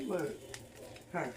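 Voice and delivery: normal talking voice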